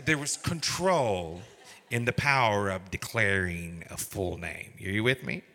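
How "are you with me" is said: ordinary talk, nothing marked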